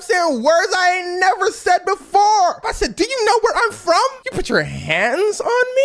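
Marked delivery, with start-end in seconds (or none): 3.0-5.8 mock outraged, high and rising; a hammed-up American telling her off; American cussing out. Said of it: "There's inflections in my tone".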